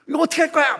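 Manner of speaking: imagined boss yelling; mock scolding. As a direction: tear into the employee like a furious manager. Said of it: "막 이렇게 나올 줄 알았는데".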